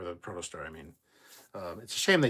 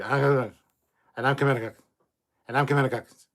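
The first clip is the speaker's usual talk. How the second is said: mock fumbled intro; 4 semitones higher, 9 dB louder than usual. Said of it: "and then it's gonna be".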